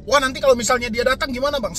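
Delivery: worried follower asking. Acted. Follower asks the adviser about collectors coming, lightly voiced worry.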